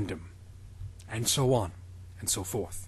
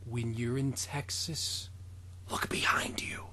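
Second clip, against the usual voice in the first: soft whispered warning; 3 semitones lower, 10 dB quieter than usual. Pretend. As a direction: breathe it like a secret. Sung theme line whispered.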